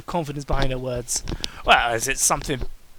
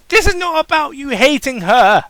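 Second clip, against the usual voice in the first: exasperated yell; 9 semitones higher, 9 dB louder than usual. Real, not acted. Reader yells at story.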